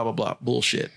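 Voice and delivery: ordinary talk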